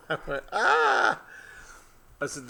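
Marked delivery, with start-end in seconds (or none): 0.5-1.2 high shriek. Retold or imitated.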